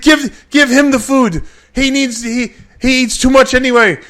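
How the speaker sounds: put-on impatient accented bodybuilder yelling; Nasser's outburst. Nasser tells waiters.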